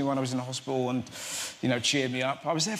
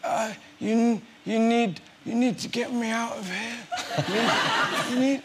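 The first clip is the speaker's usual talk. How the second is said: high, halting plea, words stumbling out; groggy, higher-pitched mock urgency; embarrassing anaesthetic babble; 8 semitones higher, slower than usual. Patient to two thumb specialists, acted embarrassing babble. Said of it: "I can hear myself saying, it's really embarrassing".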